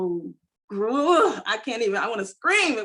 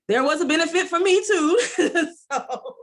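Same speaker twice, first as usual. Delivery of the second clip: laughing, pitched much higher; 9 semitones higher, 3 dB louder than usual. Real, not acted. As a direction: laugh through the admission. Laughs while admitting own benefit.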